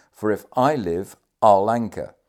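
loud, emphatic vow; forceful commander refusing to yield; energetic determination. A narrator's reading. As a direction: hit each phrase hard, strongest at the end. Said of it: "The energetic manner in which he uttered".

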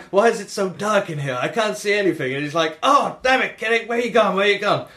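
high, whiny acted complaint; mock-distressed captain. Captain losing kitty in the dark, mock distressed.

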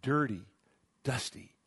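ordinary talk, nothing marked

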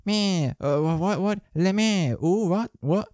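high, lightly French-tinged goat bleat. Pretend. Host voicing a goat that sounds French. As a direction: play a goat with a hint of a French accent. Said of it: "but he sounds French".

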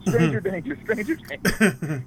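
high, slow singsong stranger danger; mock kid's alarm. A kid shrieking in the store, high and drawn out. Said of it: "waiting for him to go".

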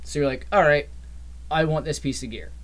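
usual speaking voice

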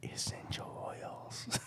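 "Essential oils" breathed as a soft, slow whisper. Real, not acted. Repeating own punchline.

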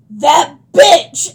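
loud mock shout of outrage; retold outburst. Waitress storms off.

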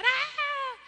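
put-on high sharp scream of a scared kid; startled child yelp. (grito agudo de niño asustado)

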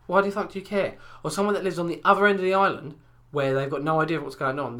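ordinary talk

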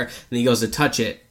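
usual speaking voice

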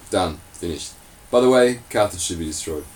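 normal talking voice

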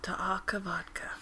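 breathy whispered ASMR read. Acted. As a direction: whisper softly into the mic. ASMR Corner whisper segment.

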